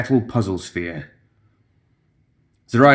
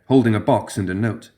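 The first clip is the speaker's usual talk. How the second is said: ordinary delivery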